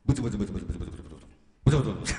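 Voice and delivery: fast low mumbled grumble; mock grumbling. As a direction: mutter like a complainer, rapid and low. Mimicking grumbling people. (早口で低くぶつぶつ文句を言う真似)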